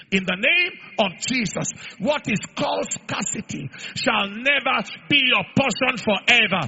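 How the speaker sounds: loud, shouted prophetic declaration; full-force preaching. Acted. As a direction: thunder it over the congregation.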